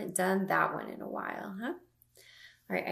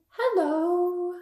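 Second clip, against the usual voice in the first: playful fish hello; 10 semitones higher, 6 dB louder than usual. Acted. Fish greeting in book.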